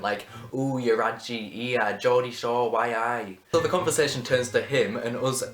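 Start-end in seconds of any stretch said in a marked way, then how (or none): 0.5-3.4 imitation Geordie; mock Geordie chatter; Newcastle accent. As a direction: put on a broad Newcastle accent. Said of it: "talking in his Newcastle accent".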